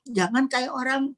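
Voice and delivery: ordinary delivery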